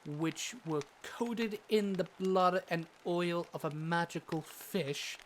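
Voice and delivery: usual speaking voice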